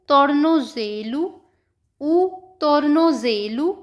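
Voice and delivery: tornozelo drawn out very slowly with a rising end; very slow Portuguese word. Shown as a demonstration. Teacher to students, sounding out tornozelo very slowly. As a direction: sound out tornozelo at half speed. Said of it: "next है".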